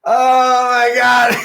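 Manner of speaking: high, loud disgusted cry; shocked shout. Real, not acted. Disgust at hair.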